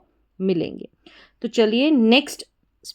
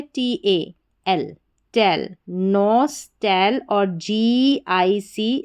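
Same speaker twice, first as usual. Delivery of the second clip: drawn-out syllables one by one; slow spelling; much slower than usual. Shown as a demonstration. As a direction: break the word apart slowly. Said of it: "तोड़ तोड़ कर पढ़ेंगे". (धीरे-धीरे तोड़कर बोलना)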